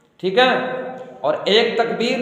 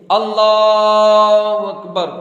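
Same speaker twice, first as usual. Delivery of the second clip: long drawn-out chanted Allahu Akbar, slow and louder; takbir call; 5 dB louder, much slower than usual. Shown as a demonstration. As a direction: call it out like the imam in prayer. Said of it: "तीसरी तकबीर बोलेंगे". (इमाम की तरह खींचकर तकबीर पुकारना)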